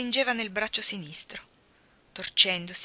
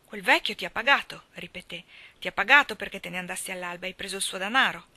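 louder, hard, insistent repeat; forceful accusation; 7 dB louder than usual. Acted. Virginie accusing the sailor of being paid, forceful. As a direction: throw the charge at him.

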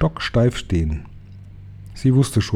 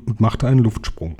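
ordinary delivery, nothing marked

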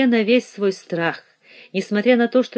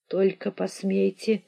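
hoarse; 7 dB quieter than usual. A narrator's reading. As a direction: croak out a quiet threat. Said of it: "прохрипел Ричардсон".

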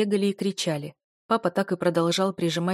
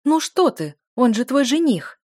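high, loud indignant reproach; mother's raised, outraged voice; 6 semitones higher, 8 dB louder than usual. A narrator's reading. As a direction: protest in scandalised disbelief. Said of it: "возмущённо ахнула мама".

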